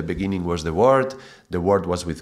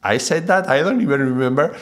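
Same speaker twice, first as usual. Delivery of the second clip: surprised; 7 semitones higher, 4 dB louder than usual. Retold or imitated. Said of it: "and you're like".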